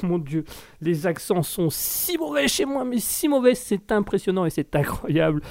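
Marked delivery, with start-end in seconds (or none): none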